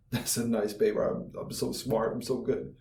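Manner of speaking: low, soft mock smugness; quiet, tongue-in-cheek self-congratulation; mock self-praise. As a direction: murmur it to yourself, pleased and low. Mocking a self-satisfied paper writer.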